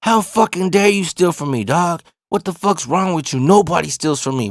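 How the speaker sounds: high-pitched indignant telling-off; angry rant. Retold or imitated. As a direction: retell yourself chewing someone out. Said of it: "I'm just talking shit to him".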